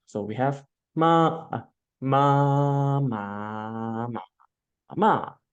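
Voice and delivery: "ma" sung through first, second, third and fourth tone in turn. Shown as a demonstration. Teacher models the four tones of "ma" for the class, slow clear demo. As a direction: model each Mandarin tone clearly on "ma". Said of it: "We use the M A as the example".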